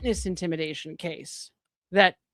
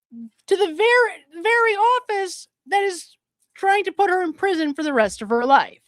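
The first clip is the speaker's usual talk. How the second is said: rising in pitch, louder as the outrage builds; outraged rant; 8 semitones higher, 3 dB louder than usual. Real, not acted. Commentator to viewers, indignant and building. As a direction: climb higher and higher on very, very office, then land hard on life.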